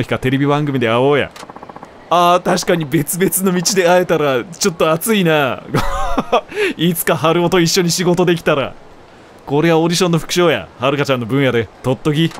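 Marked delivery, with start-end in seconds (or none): 5.7-6.7 high giggle. Real, not acted. Laugh after line.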